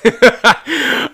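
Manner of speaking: a quick, high burst of laughter; bright amused laugh; real laugh. Real, not acted. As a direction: laugh out loud at the memory. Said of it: "I laugh at that every time".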